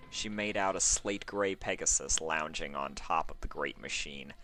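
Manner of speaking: usual speaking voice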